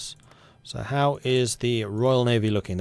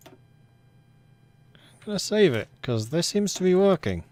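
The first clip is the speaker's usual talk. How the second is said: words forced through clenched teeth; talking through clenched teeth; 9 semitones higher, slower than usual. Real, not acted. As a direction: speak with your teeth clenched shut. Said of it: "I am completely talking through clenched teeth".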